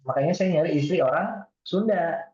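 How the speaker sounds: ordinary talk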